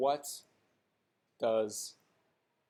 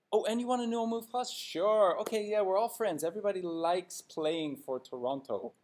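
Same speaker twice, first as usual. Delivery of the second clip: higher, sing-song fawning giveaway voice; fawning mock GM; 6 semitones higher than usual. Vlogger mocks the Leafs GM giving players whatever they want, higher and fawning. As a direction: gush like a pushover handing out money. Mocking Dubas handing out contracts.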